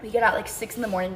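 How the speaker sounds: ordinary talk, nothing marked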